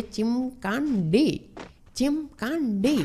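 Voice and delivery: drawn-out, high sing-song jeer; mocking taunt. Real, not acted. Gamer gloating over a beaten enemy, sing-song taunt. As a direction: stretch the name out, swooping up and down.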